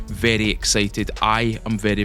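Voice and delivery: ordinary talk, nothing marked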